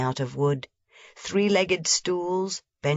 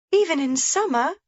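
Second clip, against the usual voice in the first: put-on lively little girl voice pitched high; a small girl pointing things out eagerly; young Heidi; 10 semitones higher than usual. A narrator's reading. Heidi telling grandmother about the shutter, bright and eager.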